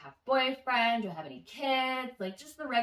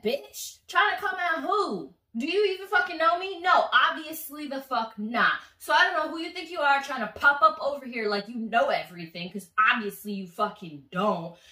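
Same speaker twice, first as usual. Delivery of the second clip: loud, higher furious tirade at the rival; angry yelling; 4 semitones higher, 6 dB louder than usual. Retold or imitated. Woman yells at rival who popped up at brunch, furious. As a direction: tear into her, voice raised and sharp.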